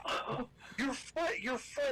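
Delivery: high, drawn-out fake wail; mock crying. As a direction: imitate a hysterical guide crying. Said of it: "He starts crying".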